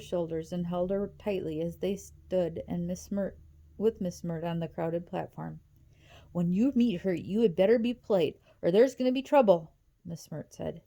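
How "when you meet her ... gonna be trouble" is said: stern threat. A narrator's reading. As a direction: threaten the girls, getting louder. Caseworker threatens the girls.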